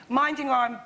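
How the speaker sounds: usual speaking voice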